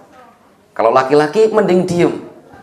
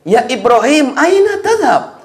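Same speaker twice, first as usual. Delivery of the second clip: lightly voiced bewildered wife, higher; a confused wife calling after her husband; Hajar's voice; 9 semitones higher than usual. Wife calls after Ibrahim, lightly voiced and bewildered. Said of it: "Istrinya bingung".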